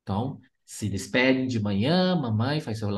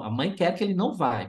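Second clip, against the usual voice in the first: normal talking voice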